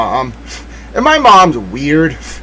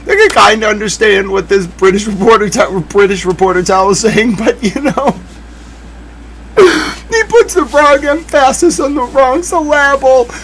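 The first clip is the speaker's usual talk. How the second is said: can't stop laughing while quoting friend; laughing through a quote; 7 semitones higher than usual. Real, not acted. Quotes Will while still laughing.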